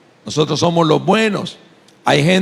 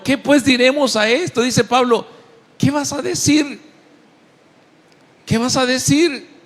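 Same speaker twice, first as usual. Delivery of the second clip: high preaching cry; 9 semitones higher than usual. Acted. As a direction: pitch lifts high as he presses the question. Preacher rising to full force.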